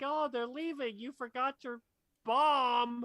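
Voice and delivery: high-pitched droid call, bomb shouted; high mock droid voice, loud finish; imitated droid. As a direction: call out high as a helpful droid, building to a loud last word. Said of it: "The droid's like".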